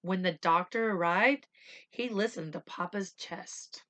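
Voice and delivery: ordinary delivery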